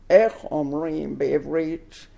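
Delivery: imitating a heavy American accent in Hebrew. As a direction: Hebrew words in a thick American accent, slowly. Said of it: "in a very American accent".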